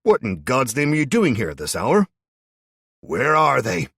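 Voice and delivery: loud, high angry questions; angry confrontation. A narrator's reading. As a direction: demand answers at the door. Man confronts Ed at door.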